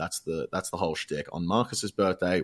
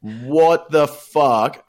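drawn-out, loud and higher, hammed-up shock; stunned disbelief; 8 semitones higher, 10 dB louder, much slower than usual. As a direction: stretch it out like you can't believe your eyes. Finding the house covered in shit.

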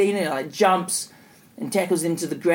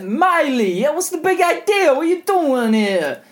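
loud, high New York mobster holler; Sopranos mobster accent; 11 semitones higher, 6 dB louder than usual. Retold or imitated. Sopranos-actor Cupid confronts Miley, hammed-up mobster accent. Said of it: "one of the actors from The Sopranos".

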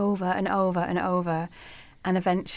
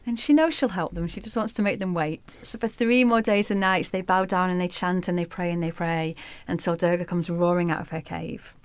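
ordinary delivery

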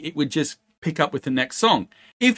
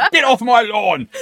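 put-on cranky old man, higher and louder, laughing; grumpy old man; 8 semitones higher, 6 dB louder than usual. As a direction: shout like a cranky old geezer. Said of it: "that stage yet of sitting on".

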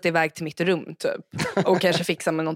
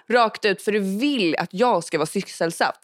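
usual speaking voice

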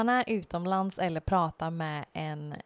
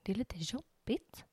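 whisper; 2 semitones higher, 8 dB quieter than usual. Real, not acted.

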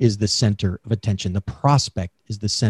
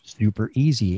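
ordinary talk, nothing marked

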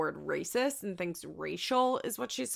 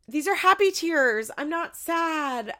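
much higher, louder, half-crying mimicry; mock tearful quote; 9 semitones higher, 6 dB louder than usual. Mimicking the mom crying to her son, higher and louder. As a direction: ham up a teary mom insisting. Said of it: "She goes".

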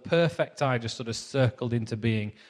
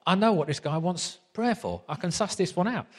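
put-on comic Essex accent; Carl's Essex accent; 6 semitones higher than usual. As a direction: imitate a confident Essex bloke. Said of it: "So I'll do the accent again".

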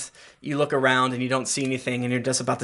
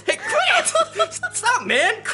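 Harper; slower than usual. Acted. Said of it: "He goes".

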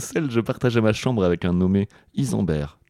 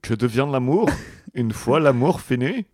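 slow, high French with a German accent; 5 semitones higher than usual. Shown as a demonstration. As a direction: read it with a heavy German accent. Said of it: "Non, avec un accent allemand".

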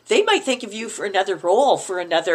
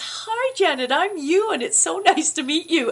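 higher-pitched imitation of a friendly greeting; friendly introduction; 8 semitones higher than usual. Actor introduces himself to a starstruck colleague, high imitated greeting. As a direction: act out a cheery hello, pitched up. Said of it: "puts his hand out, says".

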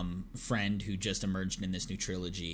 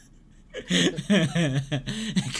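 big, high, loud laughing fit; 9 semitones higher, 8 dB louder than usual. Real, not acted. Host cracking up after quoting the line, loud laugh. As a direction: crack up hard at the punchline.

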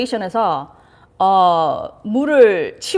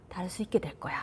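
whisper; 4 semitones lower, 16 dB quieter than usual. Shown as a demonstration. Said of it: "whispered coaching".

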